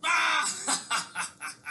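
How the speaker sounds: high, hammed-up mocking laugh. Caesar laughing at pirates.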